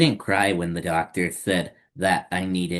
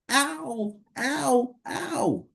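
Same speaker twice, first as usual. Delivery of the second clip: very high, whimpering ow ow ow; crying in pain; 3 dB quieter, much slower than usual. Acted. Hurting child whimpers ow in high pain.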